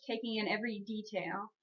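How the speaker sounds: ordinary delivery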